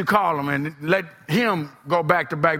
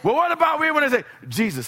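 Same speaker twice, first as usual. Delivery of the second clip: pestering objector; 8 semitones higher, faster than usual. Acted. Voices an arguer's objections.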